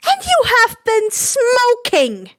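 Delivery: high, slow, indignant mother's scold; shocked scolding. Acted. Inko catching her brother smoking, slow high scold. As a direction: drag it out in disbelief.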